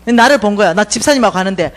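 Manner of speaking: usual speaking voice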